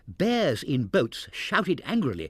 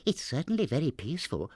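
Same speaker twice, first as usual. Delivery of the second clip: ordinary delivery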